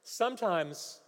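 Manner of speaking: loud, high, ringing sermon delivery; full-force preaching. Real, not acted. Preacher challenges the mighty mountain before the congregation, preaching at full force. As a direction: proclaim it forcefully, driving each phrase home.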